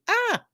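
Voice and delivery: a sudden happy squeal, high; excited high squeal. Real, not acted. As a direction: squeal with delight at a friend. Sees a friend join stream.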